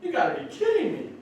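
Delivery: very high, loud, laughing "kidding me"; laughing disbelief. Retold or imitated. Speaker reacting to friend's confession, laughing disbelief. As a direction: squeal it out through a laugh. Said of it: "I go".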